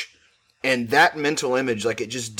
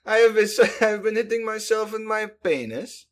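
high voice cracking with laughter; laughing; 8 semitones higher than usual. Pretend. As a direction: laugh through the words. Laughing goofy echo.

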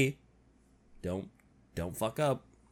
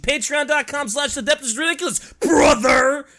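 louder, fast hype yell; wrestler shout; 5 semitones higher, 5 dB louder than usual. Acted. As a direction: yell the plug like a promo. Shouted wrestler-style plug.

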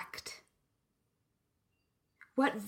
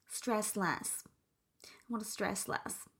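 softer, limp Stress less; mock weary intention; 7 dB quieter than usual. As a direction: sigh out the bad intention. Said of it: "setting an intention like, quote".

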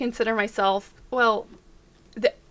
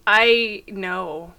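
ordinary talk

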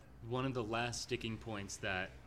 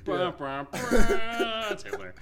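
dramatic reveal; 9 semitones higher, 11 dB louder, slower than usual. Retold or imitated.

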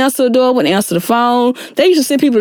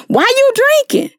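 sharp, much higher accusing question; incredulous question. Retold or imitated. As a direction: toss it up high, like people demanding why you drink. People questioning her drinking.

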